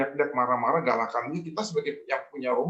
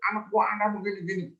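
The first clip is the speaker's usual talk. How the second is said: customer's rant, pitched higher; retelling a client who yells at staff, higher mock yell; mock angry yelling; 9 semitones higher than usual. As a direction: imitate the shouting client, half-hearted. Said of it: "Dia teriak-teriak".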